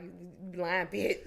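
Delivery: low, slow, drawn-out "You lying bitch"; little brother grumbling at the video; imitating brother. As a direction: drag out his imagined sneer.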